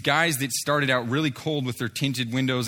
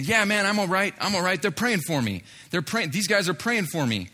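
imitated excited man, pitched up; excited reassurance; 6 semitones higher than usual. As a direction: eagerly mimic a man vouching for new friends. Excited bystander reassuring friends in a retold scene.

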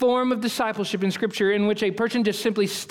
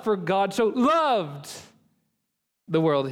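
"loved" stretched long and raised, then a pause; stress on LOVED; slower than usual. Shown as a demonstration. Professor got to love in the verse, stress on "loved". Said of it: "by the time he got to love".

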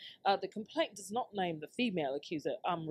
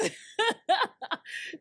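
loud high laugh; 6 dB louder than usual. Real, not acted. Laughing at her own joke.

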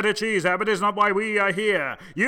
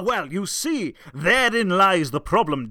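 usual speaking voice

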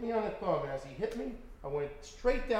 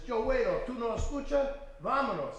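gunman's Spanish order; 7 semitones higher than usual. Retold or imitated. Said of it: "He says".

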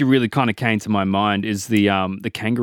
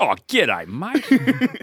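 a hammed-up Aussie outback drawl, higher on mate; broad Aussie bushman; 4 semitones higher, much slower than usual. Bushman greets a New York cop, broad Aussie accent. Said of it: "the accent is, uh, throwing me off".

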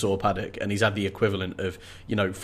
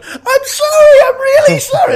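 very high, loud shriek; shrieking apology; 12 dB louder than usual. Acted. Lex apologises to Tim for coughing, shrieking. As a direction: shriek it in a mock panic. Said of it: "she just starts shrieking".

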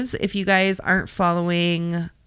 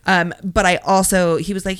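ordinary talk, nothing marked

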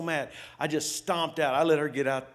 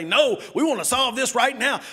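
raised, higher, louder demand; retold shouting husband; 8 semitones higher, 6 dB louder than usual. Husband yelling at wife to settle it now. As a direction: push hard, won't let it drop. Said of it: "And I'd say".

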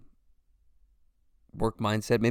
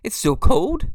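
delighted; 5 semitones higher, 7 dB louder than usual. Retold or imitated. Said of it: "and I was like".

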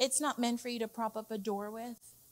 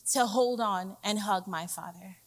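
ordinary talk, nothing marked

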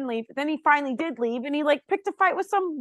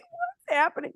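confused disbelief; 3 dB quieter than usual. Retold or imitated. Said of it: "I'm like".

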